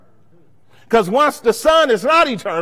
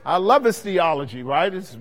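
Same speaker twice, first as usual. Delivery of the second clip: ordinary talk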